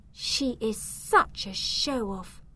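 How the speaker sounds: disapproving thought. A narrator's reading. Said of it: "Zelda thought".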